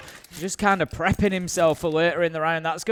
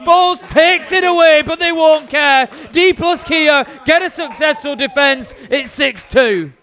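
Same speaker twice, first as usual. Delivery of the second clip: high, loud shouted round call; drawn-out shout on the kill, then easing; climactic play-by-play; 8 semitones higher, 8 dB louder, slower than usual. Real, not acted. Caster calling the successful defense, climactic shout.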